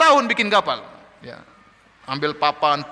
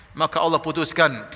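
ordinary talk, nothing marked

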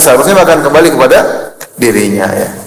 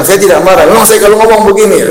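higher mock indignant voice; indignant denial; 7 semitones higher than usual. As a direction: imitate a man insisting he's not angry. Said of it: "Tapi dia bilang". (menirukan bantahan marah dengan nada tinggi)

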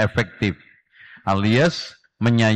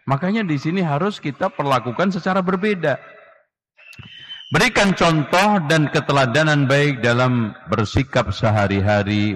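usual speaking voice

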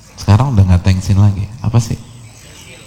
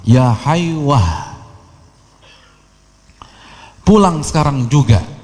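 drawn-out, higher-pitched motherly summons; mock mother's call; 4 semitones higher, slower than usual. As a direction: call out slowly like a mother summoning her son. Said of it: "ibunya teriak".

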